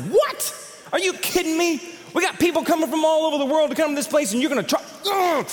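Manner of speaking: high, fast angry rant; mock outburst at the ticket desk; re-enacted fury at the price; retold blowup. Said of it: "I went nuts".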